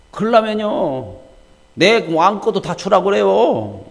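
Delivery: high, loud, slow, drawn-out sarcastic rebuke; Solomon in a temper. Acted. Solomon snapping at his mother over Abishag, angrily. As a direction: spit it back at her, furious and mocking. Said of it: "막 이렇게 성질 나가지고".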